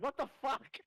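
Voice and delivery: high startled what the; shocked. Acted. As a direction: blurt it out high in disbelief. Bystander's shocked reaction voiced.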